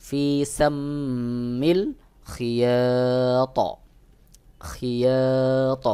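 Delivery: ফি সাম্মিল খিয়াত recited very slowly, the final ত stopped with a zabar-like bounce; Quranic recitation demo. Shown as a demonstration. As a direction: chant খিয়াত slowly, echoing the ত open-mouthed. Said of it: "এখানে উচ্চারণ হবে". (খিয়াত ধীরে, ত-তে হালকা জবরের মতো কলকলা)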